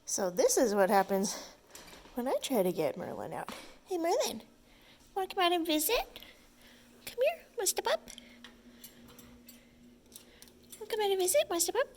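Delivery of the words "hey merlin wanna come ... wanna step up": high sing-song coaxing; high coaxing pet voice. Real, not acted. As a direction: coax the bird in a high voice. Coaxing the bird out.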